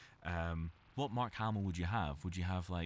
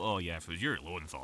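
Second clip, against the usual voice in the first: normal talking voice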